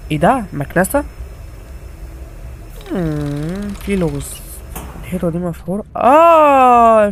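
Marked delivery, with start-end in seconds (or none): 6.0-7.1 surprised. Real, not acted. Realising the puzzle.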